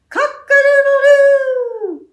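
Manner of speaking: high, slow, loud crowing; rooster crow. Acted. Storyteller crows for the rooster to wake the farmer. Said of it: "who went".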